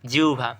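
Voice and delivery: জিহ্বা as "jiu-bha"; correct pronunciation. Shown as a demonstration. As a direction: glide "jiu" then aspirated "bha" in জিহ্বা. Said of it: "কী হবে সঠিক উচ্চারণ?". (জিহ্বাকে "জিউভা" উচ্চারণ)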